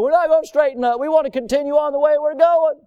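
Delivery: put-on high, defiant rebellious child; mock defiant child refusing to obey. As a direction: talk back like a stubborn kid. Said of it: "they're bucking and fighting".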